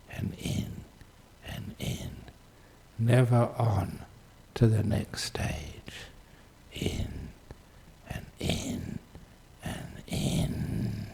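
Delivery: slow, soft, trailing into near-whisper; hushed and spacious, barely voiced; hushed meditation guidance. Real, not acted. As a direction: draw them inward with long pauses. Leads listeners inward, meditation.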